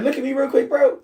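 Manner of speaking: high and insistent; urgent demand. Retold or imitated. Pressing his friend to show his face, high and insistent. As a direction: pitch it up, insist he turn around.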